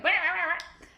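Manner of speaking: high, loud repeated "bah"; playful high "bah" bursts; imitating sound-meter bars. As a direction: mimic spiking level bars out loud. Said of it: "bars on my little screamer now are like".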